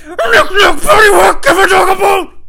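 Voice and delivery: frenzied high-pitched shriek; hammed-up screaming chant; screamed nursery rhyme. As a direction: scream the rhyme like a crazed fight anthem. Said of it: "He just started singing nursery rhymes".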